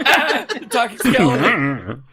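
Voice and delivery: slow, laughing through the words; can't keep a straight face, slow. Acted. Parker laughing as he meets the talking skeleton. Player character startled by skeleton.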